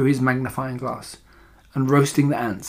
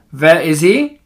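louder, sharp demanding question; snapped; 3 semitones higher, 6 dB louder, slower than usual. A narrator's reading. Grand High Witch searching the platform, snapping. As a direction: snap it out as an imperious villain. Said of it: "snapped the Grand High Witch".

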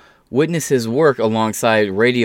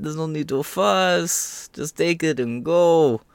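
put-on higher-pitched, sing-song Yiddish-accented old uncle voice; 6 semitones higher than usual. Mocking the Daily Wire's money talk, hammed-up Yiddish accent. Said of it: "I, I, I get really into it".

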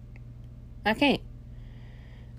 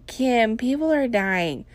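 higher, slowed celebrity quote; mock reality-star reproach, pitched up; Kourtney Kardashian; 6 semitones higher, 4 dB louder, slower than usual. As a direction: lightly imitate a sister scolding Kim, drawn out and raised. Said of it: "Kourtney Kardashian? What she told Kim".

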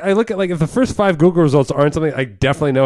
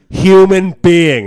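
shouting at the phone; 7 dB louder, much slower than usual. Retold or imitated. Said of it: "I always go, like".

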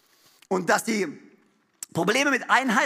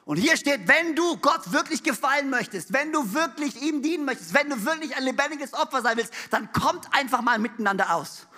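ordinary talk, nothing marked

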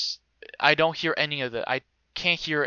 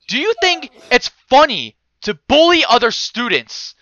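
high, very loud dean outburst, hammed-up; retold yelling; 11 semitones higher, 12 dB louder than usual. Dean accuses a student of bullying, yelling. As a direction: yell the accusation at full volume. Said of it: "he starts yelling".

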